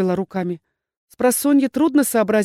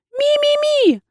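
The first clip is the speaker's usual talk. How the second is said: high squeaky chirp of a small pet; tiny creature squeak; 5 dB louder, slower than usual. Acted. Little familiar squeaks at the dean, high squeaky chirp. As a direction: chirp it like a tiny startled animal.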